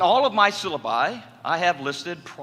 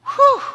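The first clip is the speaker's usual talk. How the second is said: high, loud winded exhale; breathless whew; 11 dB louder than usual. Retold or imitated. Man setting up church tables, a loud winded whew. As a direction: blow it out like you just ran stairs.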